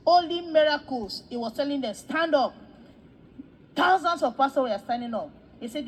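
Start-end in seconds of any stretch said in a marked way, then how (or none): 1.9-2.5 raised, louder retold order; commanding call. Man ordering thousands of pastors to stand, commanding call.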